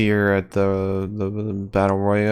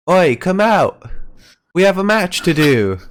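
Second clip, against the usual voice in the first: put-on Drake Maverick English accent; 9 semitones higher, 6 dB louder than usual. Co-host voicing a wrestler calling his partner out, in a loud English accent. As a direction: shout it backstage in a hammed English accent. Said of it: "'cause I'm doing more English".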